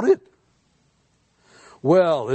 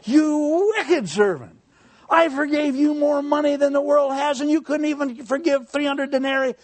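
stern rebuke; 11 semitones higher than usual. Acted. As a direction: thunder it down like a wronged king. King condemns the unforgiving servant.

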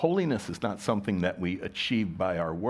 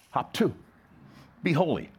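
short, raised-pitch mock order; barked command; 6 semitones higher than usual. Preacher voices "shall" misheard as an order, curt command. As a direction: order them to hop to it. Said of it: "we see that as an order, a command".

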